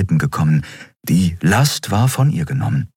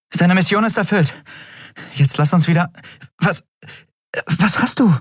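higher, uneasy woman's voice; anxious young woman; 12 semitones higher than usual. A narrator's reading. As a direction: ask nervously, faltering.

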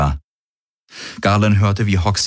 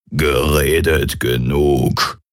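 much lower, slow, croaked and burped; croaking, belching Kilfer; 4 semitones lower, much slower than usual. A narrator's reading. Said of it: "quakte und rülpste der Kilfer".

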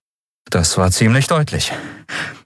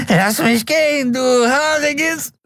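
much higher, dragged-out wail of a caught wretch; shrill captive creature; slower than usual. Acted. The dangling junkie wails at Keru, high and slow. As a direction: screech it out, stretching du haariges.

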